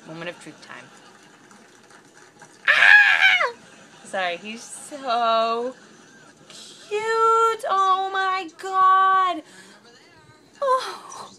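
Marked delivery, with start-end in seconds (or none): 2.7-3.5 very high, loud scream of delight; real piercing happy scream; excited scream. Real, not acted. Sees the horse's face at last. 4.4-11.3 high, loud, drawn-out squeal of adoration; real overwhelmed fangirl squealing; gushing squeal. Real, not acted. Gushing over the revealed horse.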